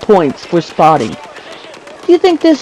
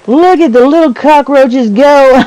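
mock taunt; 6 semitones higher, 3 dB louder than usual. Mock taunting of enemy players.

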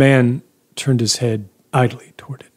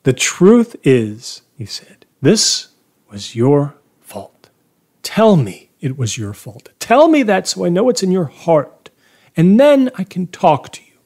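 raised, pressing demand; insistent accusation; 8 semitones higher, 5 dB louder than usual. A narrator's reading. Cornering the priest to admit fault, insistent accusation.

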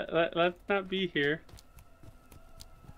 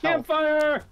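shouting; 13 semitones higher, 5 dB louder than usual. Real, not acted. Shouting in game chaos.

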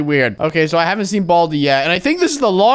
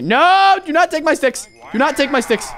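angry high yell; 10 semitones higher than usual. Real, not acted. Bully steals sticks.

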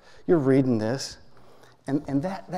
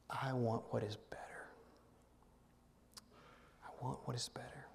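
softly whispered prayer; 12 dB quieter than usual. Shown as a demonstration. Pastor modelling the quiet prayer for the congregation, whispered. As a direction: breathe the prayer barely aloud. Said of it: "settle in on this simple prayer".

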